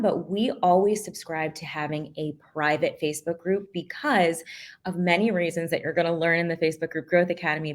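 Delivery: ordinary talk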